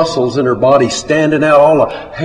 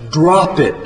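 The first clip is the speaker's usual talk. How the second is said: mild narrated yell; a slightly raised, firm order; 3 semitones higher than usual. A narrator's reading. Narrator ordering John again, yelled. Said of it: "I yell again".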